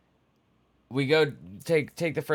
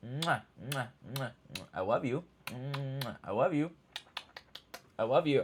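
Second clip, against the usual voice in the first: much softer kisses and love; tender kisses; 7 dB quieter than usual. Real, not acted. Owner kissing her cat goodbye, tender and soft. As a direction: hush and smooch the pet.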